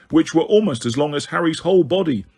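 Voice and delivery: ordinary delivery, nothing marked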